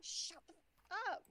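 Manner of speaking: high, slow mock exasperation; exasperated. Friend sick of the accent, imitated exasperation. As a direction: imitate a friend who has had enough. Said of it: "he absolutely hates it".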